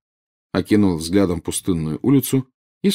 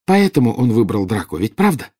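raised, pressing question; insistent; 4 semitones higher, 6 dB louder than usual. A narrator's reading. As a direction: demand confirmation through distress. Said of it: "настойчиво повторила она". (настойчиво, требовательно)

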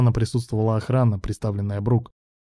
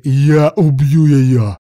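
loud, slow, drawn-out shout; yelled threat; 3 semitones higher, 9 dB louder, much slower than usual. A narrator's reading. Ruslan threatens Bruk by phone, yelling. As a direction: yell it into the phone. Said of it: "проорал в трубку Руслан".

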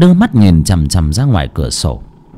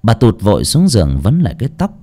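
ordinary delivery, nothing marked

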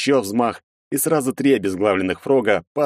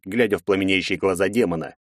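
ordinary delivery, nothing marked

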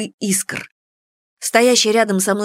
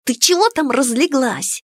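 plain reading voice

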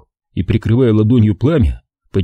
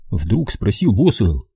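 ordinary delivery

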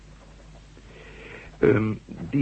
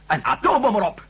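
high, loud indignation; outraged protest; 11 semitones higher, 5 dB louder than usual. Acted. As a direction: exclaim it in outraged disbelief. Outraged at bombing near New York. (luide, hoge verontwaardiging)

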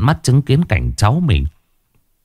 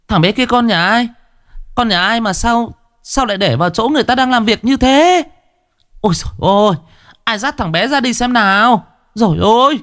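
high-pitched alarmed outcry; frantic high calling; panicked cry; 14 semitones higher than usual. A narrator's reading. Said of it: "hoảng hốt kêu lên".

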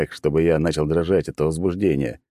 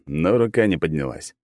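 ordinary delivery, nothing marked

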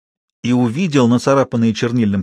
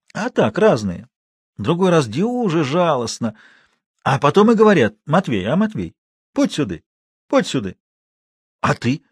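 lightly voiced rustic storyteller, higher; old watchman spinning yarn; 4 semitones higher than usual. A narrator's reading. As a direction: tell it earnestly, with short luring calls. Матвей рассказывает, изображает зовущих.

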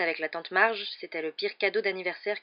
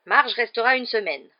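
loud, higher-pitched declaration; curt announcement; 5 semitones higher, 10 dB louder than usual. A narrator's reading. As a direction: throw it out with authority. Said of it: "lança l'oncle Vernon".

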